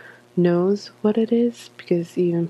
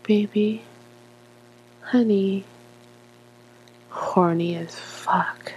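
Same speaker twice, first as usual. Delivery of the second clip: whispered; 2 semitones higher than usual. Pretend. Sultry reading of word list.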